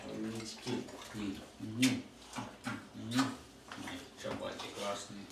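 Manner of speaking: soft admiring coo; talking to a dog. Real, not acted. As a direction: gently praise your dogs. (нежно сюсюкает с собаками)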